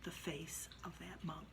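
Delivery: hushed whisper on the reveal. A narrator's reading. Storyteller reaching the scary part, eerie whisper. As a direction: drop to a whisper for the ghost. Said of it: "unvoiced narration climax".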